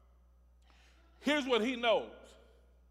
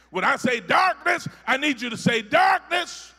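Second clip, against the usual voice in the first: emphatic preaching; 6 semitones higher, 8 dB louder than usual. Real, not acted. Preaching God's commands emphatically.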